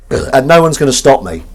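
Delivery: loud, emphatic declaration; mock defiance. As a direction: announce it defiantly to the room. Mock defiant declaration, then muttered repeat.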